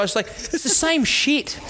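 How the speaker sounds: high, slow exasperated; mock disbelief at a petty feud. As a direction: roll your eyes through it. Mocking the olive oil feud.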